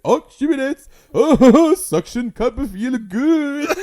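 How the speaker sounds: high, loud laughing octopus; silly octopus cooing in delight. Retold or imitated. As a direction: squeal it like a goofy octopus. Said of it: "He's like".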